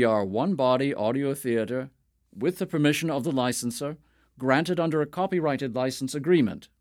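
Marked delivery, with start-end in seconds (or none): none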